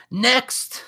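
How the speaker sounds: sharp rising "Next"; dismissive. Acted. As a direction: brush them off high and quick. In character dismissing act.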